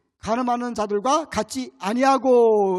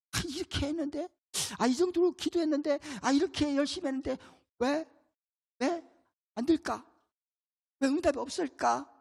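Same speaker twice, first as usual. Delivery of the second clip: whiny disappointed believer; 4 semitones higher, 3 dB quieter than usual. Acted. Preacher voices disappointed believer.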